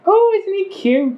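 put-on very high, cooing Scottish old lady. An old centaur lady admiring a pet, high Scottish gushing. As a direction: gush like a Scottish granny. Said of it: "little sort of Scottish old lady".

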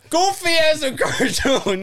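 high, slow cartoon narrator; old cartoon voice. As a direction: play it like an old cartoon announcer. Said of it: "it's that exact same voice".

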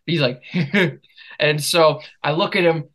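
ordinary talk, nothing marked

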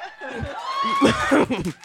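squealing burst of laughter; laughing hard at her own joke; big high laugh. Real, not acted. Guest laughing at her own joke, high squeal.

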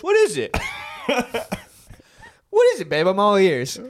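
hammed-up, all-ears partner; imitating Sean. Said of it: "Sean's like".

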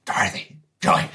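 hushed call; whispering. Acted. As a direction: call them over under your breath. Quietly calling friends aside.

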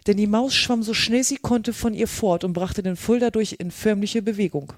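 ordinary delivery

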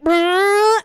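high, loud lip-buzz engine rev; schoolboy making car noises. Retold or imitated. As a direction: buzz your lips like a kid's toy car. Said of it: "drive around school like a fucking car".

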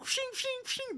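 high ringing "shing" noises, soft; sword clashing sounds. Retold or imitated. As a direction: mimic metal pieces whizzing past. Imitates heat shield pieces flying off.

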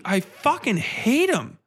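ordinary delivery

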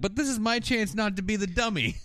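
high-pitched retold whine; imitating an earnest objection; mock pleading protest.

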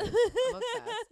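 high, loud, slow squeal of delight; gushing. Real, not acted. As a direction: squeal with adoration. Gushing about friend.

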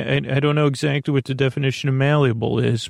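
ordinary talk, nothing marked

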